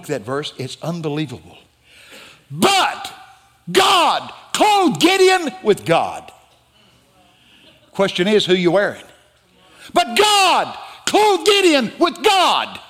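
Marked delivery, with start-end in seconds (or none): 2.5-6.3 high, very slow preaching shout; shouted proclamation. Acted. Preacher proclaims the key verse. 9.9-12.8 high, very slow preaching shout; shouted proclamation. Acted. Preacher repeats the key verse.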